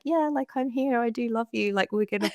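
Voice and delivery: a gentle spark cheering her on; encouraging inner voice. Retold or imitated. Inner spark reassuring her she'll recover, high and gentle. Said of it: "tiny little spark inside of me that was like".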